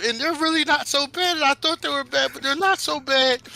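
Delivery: high-pitched, loud, tearful rambling; crying babble. Acted. Midas crying as he tells his parents about friends, tearful. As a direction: blurt it out in a high crying wail. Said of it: "And he's crying".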